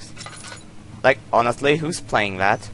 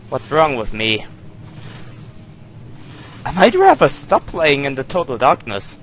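ordinary talk

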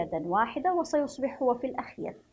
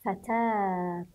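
stretched slowly, the long vowel held in فتاة; drawn out; much slower than usual. Shown as a demonstration. Teacher says فتاة for the pupils, slowly drawn out. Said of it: "ونقول".

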